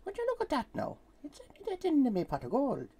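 high Irish leprechaun voice; high, lilting Irish brogue. Pretend. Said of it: "making leprechauns jealous green".

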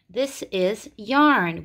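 "yarn" said slowly with a clear Y at the start; slow model word. Shown as a demonstration. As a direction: spell out "yarn" slowly for the class. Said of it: "here it makes the Y".

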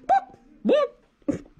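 very high cluck; polite hen cluck. Acted. Hen asking the librarian for a book, clucking. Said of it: "clucked the hen".

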